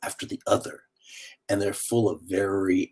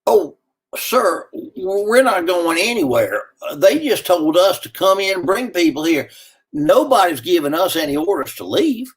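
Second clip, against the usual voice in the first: a hint of a helpless bus driver, higher; higher-pitched, apologetic shrug of a voice; bus driver's reply, lightly voiced; 8 semitones higher than usual. Bus driver telling the stranded man nobody leaves, lightly voiced.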